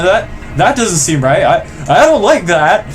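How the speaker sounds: ordinary talk, nothing marked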